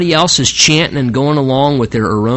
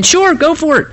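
much higher, mock-agreeable; sarcastic antic; 12 semitones higher than usual. Prophet humours the king's war plan, sarcastic. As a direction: pretend to cheer him on. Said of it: "some kind of sarcastic antic where he's saying".

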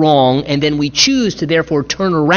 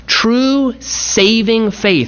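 "saving faith" stretched out slowly and raised in pitch; emphasis on saving faith; 6 semitones higher, much slower than usual. Real, not acted. Preacher driving home true saving faith to the congregation, slow emphasis. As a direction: lean hard on "saving faith", word by word. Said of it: "I emphasize the word saving faith".

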